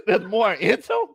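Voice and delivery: high incredulous question through laughter; laughing disbelief. Retold or imitated. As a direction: echo it back, cracking up. Incredulous echo of cousin's nonsense word.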